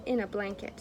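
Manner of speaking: ordinary delivery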